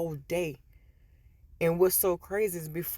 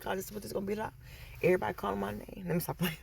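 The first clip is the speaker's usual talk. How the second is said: muttered to self; 9 dB quieter, much faster than usual. Retold or imitated. Overwhelmed muttering to herself.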